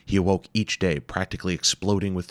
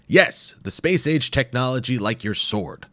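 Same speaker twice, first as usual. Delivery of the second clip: dry; 7 semitones higher, 4 dB louder than usual. A narrator's reading. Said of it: "Doctor Wimple said dryly".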